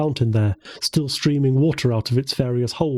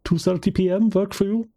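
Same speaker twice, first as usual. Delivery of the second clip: put-on Russian accent; 7 semitones higher than usual. A narrator's reading. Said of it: "Nikolai's thick Russian accent".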